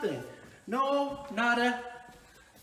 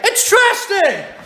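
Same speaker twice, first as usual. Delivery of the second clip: slow exasperated shout; 7 semitones higher, 4 dB louder, slower than usual. Real, not acted.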